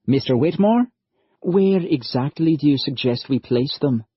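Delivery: put-on earnest young lad from Ireland; young Irish lad. Narrator voices Liam again.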